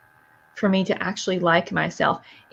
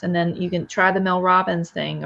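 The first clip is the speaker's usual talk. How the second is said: ordinary talk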